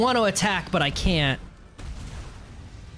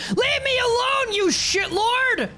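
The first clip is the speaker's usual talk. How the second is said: high frantic pleading shout, much higher; panicked yelling; 10 semitones higher than usual. Real, not acted. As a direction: beg the monster to back off, screaming high. Monster chasing him, desperate yelling.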